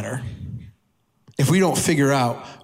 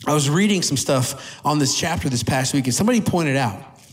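ordinary delivery

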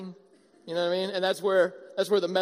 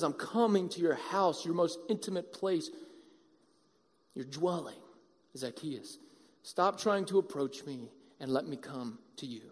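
lower, softer invitation; gentle Jesus; 7 semitones lower, 8 dB quieter than usual. Acted. Jesus calling Zacchaeus down, low and gentle. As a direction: offer it quietly, like a tender call. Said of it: "Jesus says".

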